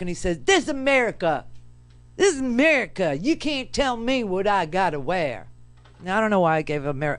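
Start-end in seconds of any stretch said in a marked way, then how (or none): 0.4-5.5 put-on old man's indignant Southern accent. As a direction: protest as a stubborn Southern old man. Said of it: "a guy from Maryland a Southern accent".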